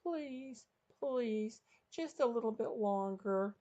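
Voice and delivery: put-on high-pitched pleading girl's voice; Sherry pleading. A narrator's reading. Sherry begging the man for a little longer, pleading. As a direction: plead in a small high voice, drawn out. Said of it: "Sherry pleading with someone".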